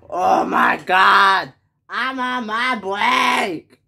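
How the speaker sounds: slow, high-pitched exasperated yell; yelling boss. Acted. Boss interrupted on break, yelling at the worker. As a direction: drag out an annoyed shout. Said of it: "he yells at me a lot".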